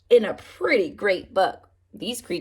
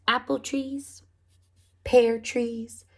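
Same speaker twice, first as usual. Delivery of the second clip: ordinary delivery, nothing marked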